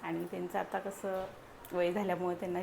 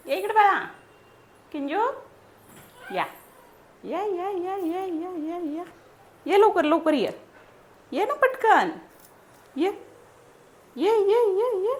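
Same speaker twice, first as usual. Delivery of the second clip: high, loud sing-song coaxing; 11 semitones higher, 10 dB louder than usual. Real, not acted. Mother calls baby to come.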